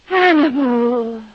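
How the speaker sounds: slow, drawn-out cry of his name; swooning cry. Acted. Tildy overcome by Hannibal's love scene, swooning. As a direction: melt and breathe his name as you faint.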